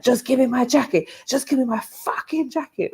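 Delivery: plead-yell it like the guy; mock screaming. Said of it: "he kept screaming for his jacket".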